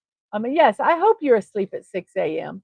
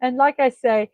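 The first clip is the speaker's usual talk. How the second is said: ordinary delivery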